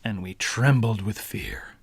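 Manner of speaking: hushed, unvoiced whisper; fearful story whisper; spooky whisper. A narrator's reading. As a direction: breathe it out like a scared secret.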